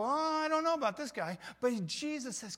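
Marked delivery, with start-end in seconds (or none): 0.0-1.4 put-on high, louder doubtful kid voice; wary child. Child eyeing a grumpy grown-up, high and wary. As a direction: hang back like a nervous child. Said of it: "kids go".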